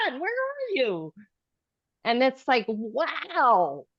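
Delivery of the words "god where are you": high, softer pleading call to God; a hushed, high plea upward. Retold or imitated. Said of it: "I'm like".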